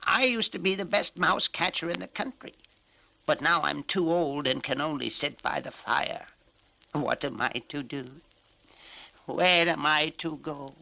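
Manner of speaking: cat voice. Acted. Cat tells its troubles.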